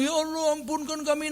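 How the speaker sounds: soft, high weepy pleading; mock tearful prayer. Preacher imitates worshipper begging God for mercy, mock tearful pleading.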